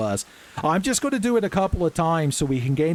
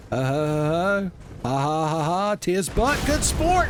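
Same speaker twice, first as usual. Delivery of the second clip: hammed-up knight laugh; 4 semitones higher than usual. Mock knightly laugh, archaic phrase.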